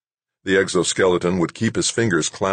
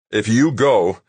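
raised, louder determination; fierce vow through a tense voice; mean it grimly, with force; 5 semitones higher, 5 dB louder, slower than usual. A narrator's reading. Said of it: "Doug said grimly, fiercely".

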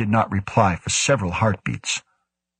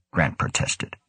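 ordinary delivery

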